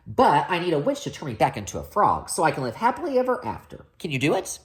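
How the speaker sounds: fast, rushed plea; rushed breathless prince; one long breath. Acted. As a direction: blurt it all out at once. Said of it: "asked the prince in one long breath".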